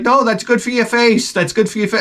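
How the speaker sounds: high, breezy imitation of the wife; lightly mocking wife reassuring him; wife's voice.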